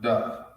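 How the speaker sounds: slow "Da", clear d and open a; letter Da. Shown as a demonstration. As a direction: model "Da" slowly for the class. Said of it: "It's pronounced".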